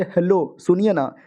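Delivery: normal talking voice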